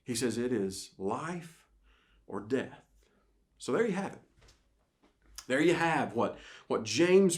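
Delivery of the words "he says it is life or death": life or death laid out slowly; slow emphatic phrase. Acted. Pastor stressing the stakes to his congregation, slow and weighted. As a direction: let each word drop, unhurried. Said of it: "preacher repeats key phrase slowly".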